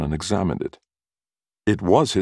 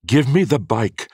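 higher, louder demand; raised, forceful order; 8 semitones higher, 5 dB louder than usual. A narrator's reading. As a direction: insist on getting it back. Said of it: "Ferd demanded".

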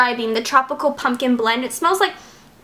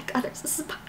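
whisper; 2 semitones higher, 14 dB quieter, faster than usual. Real, not acted. Spots spider again.

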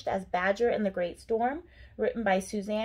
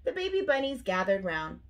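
plain reading voice

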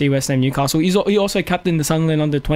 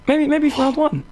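pitched way up, playful; high joking voice; 10 semitones higher than usual. Pretend. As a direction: toss it off in a high silly voice.

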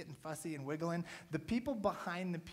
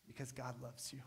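whisper; 6 semitones lower, 10 dB quieter than usual. Real, not acted. Sermon climax.

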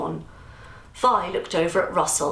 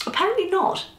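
smiling; 13 semitones higher, faster than usual. Acted. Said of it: "Robert smiled".

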